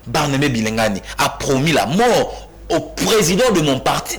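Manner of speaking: ordinary talk, nothing marked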